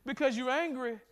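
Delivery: ordinary talk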